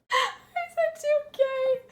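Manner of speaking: squealing the words through laughter; laughing high squeal. Real, not acted. Laughing at herself for telling her friend. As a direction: cry them out in helpless high laughter. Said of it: "I can't believe I told her that".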